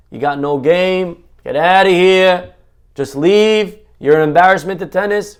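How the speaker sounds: loud, slow heckling from the back row; heckler jeering. Acted. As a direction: jeer at the player like a lowlife heckler. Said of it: "a heckler".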